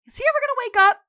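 loud, high-pitched blunt demand. Retold or imitated. Chucky asks about dying Frank, blunt and loud. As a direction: imitate Chucky bluntly blurting it out at full volume. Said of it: "ever the blunt one".

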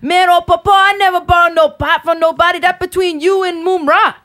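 high, loud Cajun papa voice; Cajun accent. Retold or imitated. Quoting the Cajun cartoon voiceover to friends, Cajun accent. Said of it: "voiceover in a Cajun accent".

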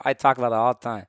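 normal talking voice

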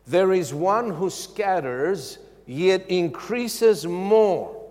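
slow, measured reading. Acted. Preacher first reading the verse, slow and measured. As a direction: read it slowly, weighing each phrase.

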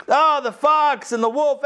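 the pack turning on their prey; indignant mob. A narrator's reading. The animals gang up on the sheep, high and outraged.

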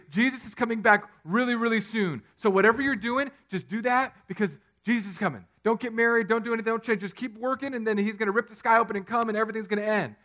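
loud, high-pitched mock exhortation; rally everyone as if time runs out; hammed-up breathless urgency of an apostle; acted urgent excitement. Acts out Paul's urgent expectation.

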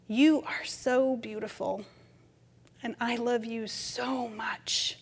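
slow, hushed loving words fading to a whisper; tender cooing. Retold or imitated. Grandmother whispering love to newborn grandson, tender. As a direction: murmur love to the baby.

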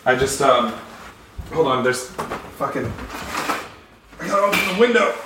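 talking while getting up from chair; getting up from chair mid-sentence. Real, not acted. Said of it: "sorry, I gotta get up here for a quick second".

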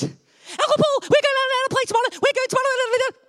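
put-on very high, fast, squealing child voice; breathless-fast high-pitched little girl impression; excited little niece. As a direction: gabble it like a kid bursting with excitement. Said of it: "And that's how she talks".

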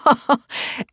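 brief amused laugh; a quick dry laugh; short laugh. Acted. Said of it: "Callista laughed".

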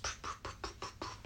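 soft unvoiced poof sounds puffed out; whispered poofs. Real, not acted. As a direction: breathe the poofs out like tiny puffs of air. Playful whispered sound effects.

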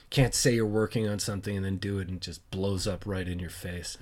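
ordinary talk, nothing marked

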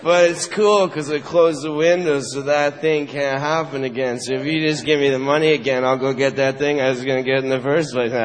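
low, fast wheedling patter; junkie hustle. Acted. Junkie asking for the money again, low and fast.

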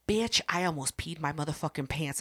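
ordinary talk

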